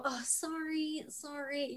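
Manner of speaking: slow, very soft apology; meek mock apology. Actor stuck on a line in rehearsal, meek soft apology. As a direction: apologize under your breath, drawn out.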